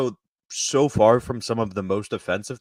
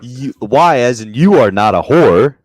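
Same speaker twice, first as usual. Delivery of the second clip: much louder excited cut-in; excited outburst; 3 semitones higher, 13 dB louder, slower than usual. Real, not acted. Cohost cutting in about the Indian lady, loud and excited. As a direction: blurt it out over them.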